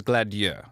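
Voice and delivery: "Gladieux" with a French ending; official French pronunciation. Shown as a demonstration. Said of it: "the official pronunciation".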